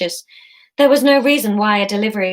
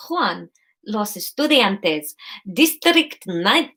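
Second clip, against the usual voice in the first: put-on slow, laboured Spanish accent; attempted Spanish accent; much slower than usual. Courier reading a label, careful put-on Spanish accent. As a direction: feel slowly for a Spanish accent.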